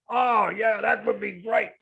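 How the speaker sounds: big, loud, high-pitched Aussie bloke enthusiasm; outward Aussie bloke. Retold or imitated. Aussie mentor answering his young mentee, hammed-up enthusiasm. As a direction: gush like an over-expressive Aussie bloke. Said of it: "very outward in your expressions".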